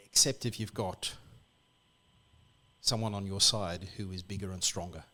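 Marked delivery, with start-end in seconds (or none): none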